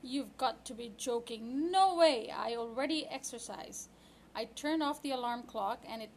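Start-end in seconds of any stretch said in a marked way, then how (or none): 1.7-2.3 loud indignant "no way"; loud refusal. Acted. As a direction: blurt it out in protest. Refusing early wake-up.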